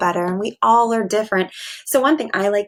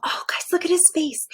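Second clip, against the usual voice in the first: whisper; 8 semitones higher than usual. Real, not acted. Teacher whispering to her class over the sleeping Percy picture. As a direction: whisper so you don't wake him.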